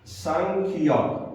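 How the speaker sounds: सांखियॉक said slowly, syllable by syllable; slow demo word. Shown as a demonstration. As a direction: draw out सांखियॉक for the learner. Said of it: "मैं लिख देता हूं ताकि आपको". (सांखियॉक को धीरे-धीरे, साफ़ बोलें)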